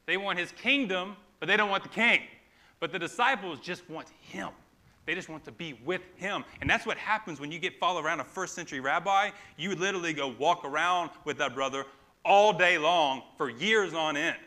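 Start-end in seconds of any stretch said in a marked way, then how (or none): none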